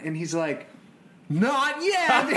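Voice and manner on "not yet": put-on much higher, louder little boy voice; playful child voice. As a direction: squeak it out like a cheeky kid refusing. Son answers from the tent.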